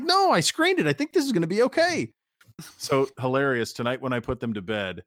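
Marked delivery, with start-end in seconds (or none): 0.0-2.1 husband pleading innocence to his wife, very high; mock innocent defense. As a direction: voice jumps way up in a squeaky mock-innocent plea.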